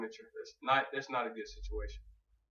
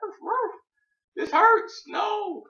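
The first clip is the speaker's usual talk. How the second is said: very high yelping dog cries; high-pitched pained dog voice; whimpering dog voice; 7 dB louder, much slower than usual. Retold or imitated. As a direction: squeal it like a hurt puppy. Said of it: "you're like".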